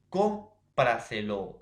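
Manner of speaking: "cómpraselo" slow and loud on "cóm"; acento en cóm. Shown as a demonstration. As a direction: stretch "cómpraselo", punching the first syllable. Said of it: "es tras antepenúltima sílaba". («cómpraselo» lento, con fuerza en «cóm»)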